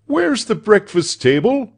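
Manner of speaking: high, loud demand; demanding master. A narrator's reading. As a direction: call the question out sharply to a servant. Doctor demands of his servant.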